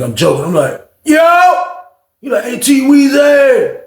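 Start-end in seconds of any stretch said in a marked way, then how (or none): 1.0-1.6 loud high shout of yo; sharp loud yo, like answering a knock; calling out. Retold or imitated. 2.4-3.8 high, slow, loud drawn-out call; imitating Frank's voice. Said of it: "That's how his voice was".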